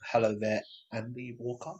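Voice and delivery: the name dropped to a near murmur; awkward greeting that fades out on the name; odd greeting. Real, not acted. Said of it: "I don't know why I said it like that".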